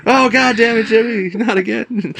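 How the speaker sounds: high, slow, weary scolding; exasperated handler. Retold or imitated. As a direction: whine it up high, fed up. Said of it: "the handler is like".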